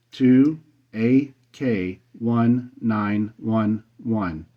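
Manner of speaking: slow, separated letters and numbers; careful dictation. Real, not acted. Operator repeating his call sign for the search box, careful dictation. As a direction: spell it out one character at a time.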